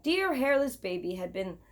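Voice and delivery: plain reading voice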